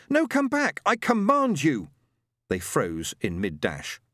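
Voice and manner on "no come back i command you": loud, high desperate command; commanding shout. A narrator's reading. Boy commands fleeing friends.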